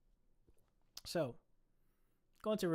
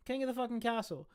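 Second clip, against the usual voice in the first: higher and louder, crowning the ferret; triumphant proclamation; 8 semitones higher, 5 dB louder than usual. Real, not acted. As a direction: announce it like a coronation. Punchline about pampered ferret.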